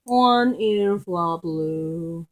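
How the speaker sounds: long, lingering pet-name coo; drawn-out repeat. Real, not acted. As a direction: stretch each word right out. Repeats the dog's nickname drawn out.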